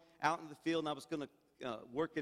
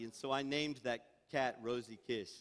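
ordinary delivery

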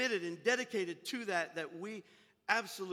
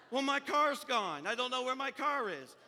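higher, slightly louder worried woman; acted distress; 4 semitones higher, 5 dB louder than usual. Woman who cannot find her car, acted distress. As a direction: voice a woman starting to panic.

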